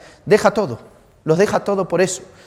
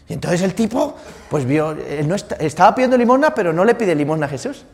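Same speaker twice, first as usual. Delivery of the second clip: ordinary delivery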